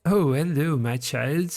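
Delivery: mock French mother's lilting greeting; French accent. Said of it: "do this with a French accent because she's French".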